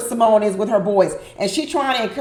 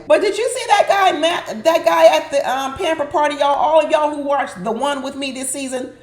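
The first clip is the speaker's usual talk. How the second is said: ordinary delivery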